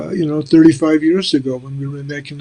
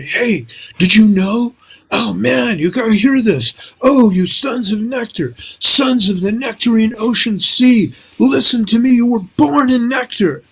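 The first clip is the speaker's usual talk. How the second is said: put-on raised, wide-eyed voice of an eager young student; eager young devotees quoting; 6 semitones higher than usual. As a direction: pass on the teaching with youthful zeal, pitched up. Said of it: "young people are coming up to me and they're telling me".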